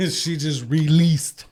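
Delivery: slow, slurred words; slurring. Real, not acted. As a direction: drag and slur the words. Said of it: "I don't know why I'm slurring here".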